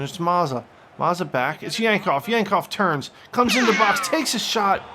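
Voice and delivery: play-by-play commentary. Acted. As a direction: call the action like a TV commentator. Commentates his own FIFA match.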